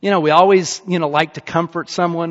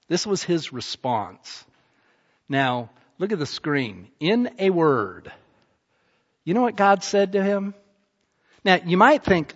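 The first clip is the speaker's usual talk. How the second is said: ordinary delivery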